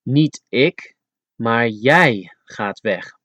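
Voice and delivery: slow, with "jij" stressed and falling; weight on "jij" against "ik"; contrastive stress on jij. Shown as a demonstration. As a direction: set "Niet ik" against a leaned-on "jij". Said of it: "the emphasis is placed on the word you".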